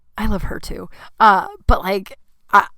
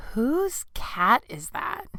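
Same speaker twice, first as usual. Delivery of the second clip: slow, softer, drawn-out disbelief; appalled question; 3 semitones higher, 8 dB quieter, much slower than usual. Retold or imitated. Neighbor asks about the wailing cat, appalled. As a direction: stretch it out, rising at the end.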